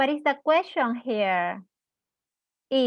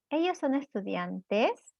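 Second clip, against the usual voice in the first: softer Spanish question, "estudiantes" rising sharply at the end; question intonation; 5 dB quieter than usual. Shown as a demonstration. Teacher showing the same intonation in Spanish, "estudiantes" rising at the end. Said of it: "vamos a tener esta entonación".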